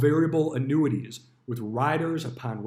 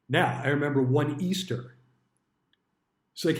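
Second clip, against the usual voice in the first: ordinary talk, nothing marked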